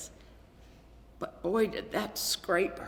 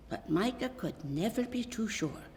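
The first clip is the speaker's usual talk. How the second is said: plain reading voice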